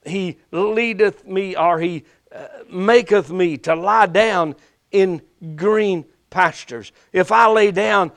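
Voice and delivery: slow, rising preacher's cadence; preaching. Acted. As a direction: preach it with fervor. Preaching on Jehovah Shalom.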